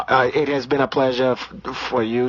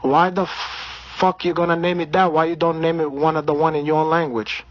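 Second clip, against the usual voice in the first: put-on Brazilian accent; 3 semitones higher than usual. Comedian holding a put-on Brazilian accent.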